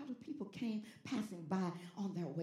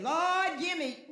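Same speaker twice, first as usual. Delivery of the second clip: loud, high sudden call; mock yelled demand; 9 semitones higher, 10 dB louder, much slower than usual. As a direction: yell a demand up to God. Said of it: "they gonna yell out".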